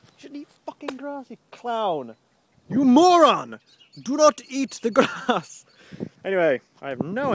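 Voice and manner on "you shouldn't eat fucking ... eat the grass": high-pitched rant bursting into shouts; exasperated scolding. Real, not acted. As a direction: berate a naughty dog loudly. Berating the dog over grass.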